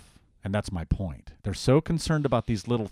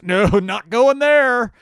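much higher and louder, slow and stubborn; old Adam refusing; 13 dB louder, much slower than usual. Retold or imitated. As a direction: shout a flat refusal like a sulky sinner. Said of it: "And the old Adam says".

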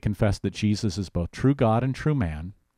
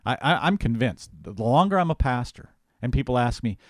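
ordinary talk

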